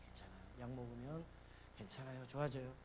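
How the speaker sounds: soft, low and steady; hushed reassuring pastor; calm reassurance. Retold or imitated. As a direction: quietly soothe the worried elders.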